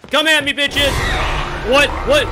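loud, high excited calls; hyped shouting. Real, not acted. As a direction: pump yourself up, yelling. Hyped challenge and greeting.

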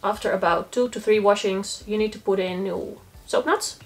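usual speaking voice

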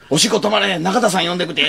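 high, loud panicked grandma; urgent yell. Retold or imitated. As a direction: shout urgently for help.

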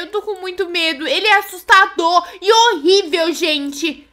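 usual speaking voice

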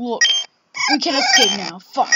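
ordinary delivery

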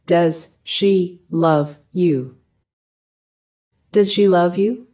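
ordinary talk

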